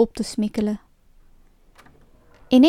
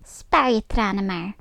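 a touch lower, heartless witch; cold old witch; 3 semitones lower than usual. A narrator's reading. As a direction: brush off her tears like a cruel witch. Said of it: "zei de oude heks".

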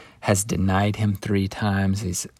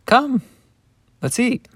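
much higher, light and welcoming; inviting Jesus; 14 semitones higher than usual. Acted. Jesus invites the disciples to eat, much higher and welcoming. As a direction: call them in gently to breakfast.

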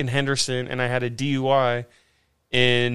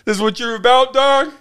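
loud, high sneering jab; taunting the cop; 9 semitones higher, 7 dB louder than usual. Retold or imitated. Drunk guy jeering at his arresting officer, loud and high. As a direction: push his buttons with a sneer. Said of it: "I hit him with that sort of thing".

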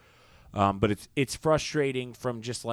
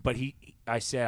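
usual speaking voice

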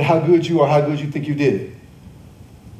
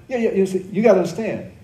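usual speaking voice

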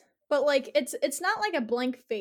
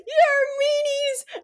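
put-on much higher, slow, whiny kid voice; pouting child; 7 dB louder, much slower than usual. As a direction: drag out the kid's sulky insult. Child storms out.

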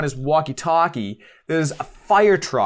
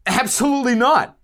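emphatic denial; 9 semitones higher than usual. Real, not acted.

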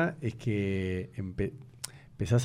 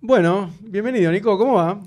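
upbeat radio welcome, higher and louder; host greeting the guest at the start of the interview; host intro; 7 semitones higher, 6 dB louder than usual. Acted. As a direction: open the show with energy. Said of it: "host welcomes guest on air".

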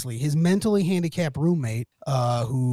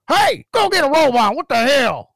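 loud, high mock yell; retold shout, sharp and exasperated; imitated scolding holler; 10 semitones higher, 8 dB louder than usual. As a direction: holler it at your roommate across the house. Man scolding pantless roommate.

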